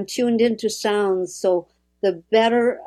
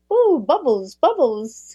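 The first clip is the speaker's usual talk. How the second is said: a light, bubbly, higher mock delight; bubbly joy; 5 semitones higher, 3 dB louder than usual. Speaker imitating southern French speech as bubbly, higher mock delight. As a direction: play the fizzy, joyful lilt of southern French. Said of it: "they speak as if it's bubbles".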